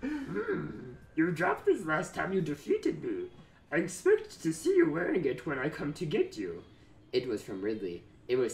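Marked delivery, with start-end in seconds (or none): 0.0-6.6 high, odd little Yoda voice, imitating. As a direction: play it as a wise little green master. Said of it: "I could do Yoda".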